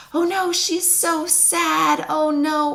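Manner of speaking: mock-worried inner voice; worried parent's thoughts. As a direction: voice the fretting parent in your head, mildly. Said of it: "we think".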